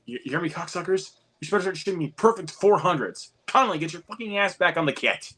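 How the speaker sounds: high-pitched hammed-up drill-master tirade; mock abusive conductor; J K Simmons impression. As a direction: berate them like a bullying band teacher. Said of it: "J K Simmons delivers some of the best one-liners".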